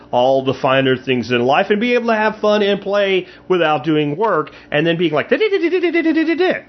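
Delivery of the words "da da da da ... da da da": high, fast babbling imitation of her chatter; mock chatter. Mocking the girl's nonstop talking on the show, high rapid babble. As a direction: rattle it off like someone who never stops talking. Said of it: "the cadence of the voice".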